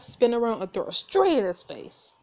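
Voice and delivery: usual speaking voice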